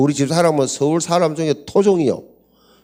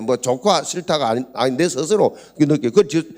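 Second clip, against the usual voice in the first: ordinary talk, nothing marked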